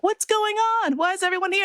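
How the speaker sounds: high, bewildered mock questions; confused husband. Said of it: "he's looking around like".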